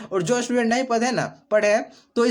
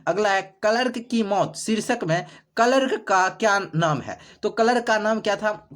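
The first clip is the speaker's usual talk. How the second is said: ordinary delivery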